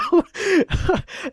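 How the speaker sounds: high-pitched burst of laughter; incredulous laugh. Real, not acted. Storyteller recalling the outlandish talk, incredulous laugh.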